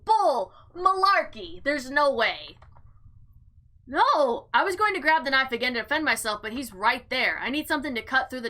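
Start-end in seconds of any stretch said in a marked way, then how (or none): none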